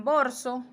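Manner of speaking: borso, L swapped to R. Shown as a demonstration. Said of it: "escriben borso".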